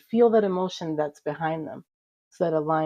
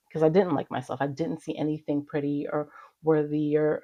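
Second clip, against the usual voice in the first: normal talking voice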